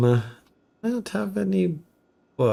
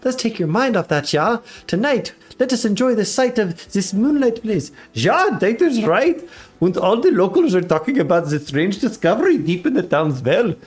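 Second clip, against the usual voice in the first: higher, hammed-up German accent; cartoonish German accent, pitched up; 5 semitones higher than usual. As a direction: put on a lilting mock German voice. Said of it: "German accent".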